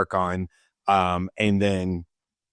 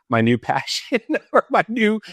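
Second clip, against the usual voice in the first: laughing; 11 semitones higher than usual. Real, not acted. Laughing at own idea.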